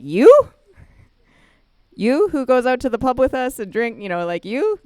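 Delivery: high, loud disbelief; can't believe it's him; shocked coworker, loud and high; incredulous. Retold or imitated.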